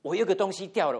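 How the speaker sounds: imitating a frantic cry over a lost item; panicked. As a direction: blurt it out like a mother in sudden panic. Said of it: "很惊慌的告诉我们说".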